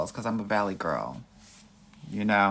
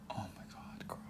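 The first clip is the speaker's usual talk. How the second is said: breathy shocked whisper; a stunned aside to the chat, whispered; 2 semitones lower, 19 dB quieter than usual. Real, not acted. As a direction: whisper it in disbelief. Shocked at a viewer's question.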